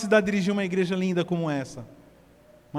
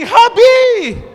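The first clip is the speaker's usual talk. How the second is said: much higher, much louder recognizing shout; joyful cry; 12 dB louder than usual. Acted. Mary turns and answers Jesus, loud cry. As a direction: burst out in recognition. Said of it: "Ela ora e fala assim".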